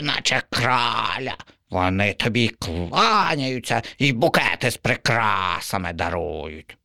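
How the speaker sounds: boasting crow. Acted. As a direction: paint a fancy picture. Crow describes cats.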